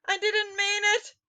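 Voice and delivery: high, loud frightened plea; cried out. A narrator's reading. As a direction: beg for mercy in panic. Culprit pleads.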